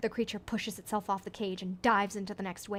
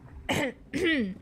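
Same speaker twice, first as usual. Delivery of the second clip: throat clearing; 4 semitones higher than usual. Acted. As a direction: clear your throat for attention. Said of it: "You clear your throat".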